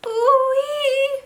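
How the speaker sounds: a very high, loud squealing cry; gnome squeal. Acted. Captured gnome squealing in fright, very high and loud. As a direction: squeal like a terrified little creature. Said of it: "squealed the Earthman".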